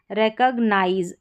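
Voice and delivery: रिकॉग्नाइज said REK-ug-nize; short "kug" in the middle of रिकॉग्नाइज; correct pronunciation. Shown as a demonstration. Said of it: "सीओजी है इसको कग करके".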